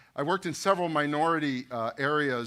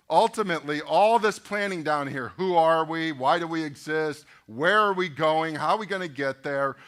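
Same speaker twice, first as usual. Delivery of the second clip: louder, punchy preacher drive; preaching at full force; 3 semitones higher, 6 dB louder than usual. Acted. Pastor presses the church with leadership questions, loud preaching. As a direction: hammer each question home to the congregation.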